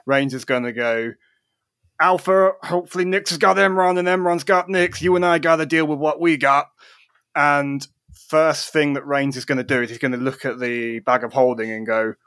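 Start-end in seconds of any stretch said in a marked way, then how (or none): 2.0-6.7 raised, higher in-character voice; urgent rallying call. Acted. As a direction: call to an ally mid-battle. Acting Rains rallying an ally.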